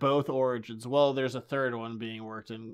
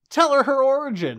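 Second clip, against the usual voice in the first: much higher and louder, urging the mother; frustrated viewer shouting at the mother; exasperated yell; 11 semitones higher, 10 dB louder than usual. Acted. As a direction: shout at the screen. Yelling at the screen.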